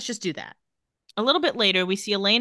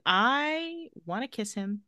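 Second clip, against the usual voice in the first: mock swooning; 3 semitones higher, 4 dB quieter, slower than usual. Jokingly voicing a smitten woman's reaction.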